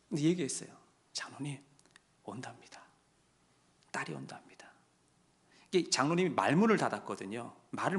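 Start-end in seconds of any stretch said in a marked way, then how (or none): 1.1-4.7 hushed news. Acted. Telling dying elder daughter comes.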